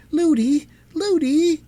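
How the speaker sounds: put-on high calling out of a frightened little girl; crying out. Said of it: "cried the princess".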